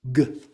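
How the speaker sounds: "G" sounded as a short hard "guh". Shown as a demonstration. Teacher sounding out the letter, "G" as hard guh. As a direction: give "G" as the sound in go. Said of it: "it's a hard G".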